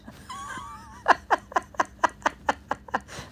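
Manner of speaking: long high-pitched laughing fit; high cackling laugh. Real, not acted. Joking about the deathbed on a stream, laughing hard. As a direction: crack up in high, helpless cackles.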